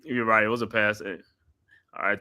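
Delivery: usual speaking voice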